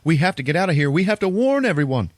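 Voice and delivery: high mock-desperate warning; urgent panic. Priest urging Liz to flee, in high mock panic. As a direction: urge it high, hammed-up panic.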